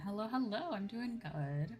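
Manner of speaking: ordinary talk, nothing marked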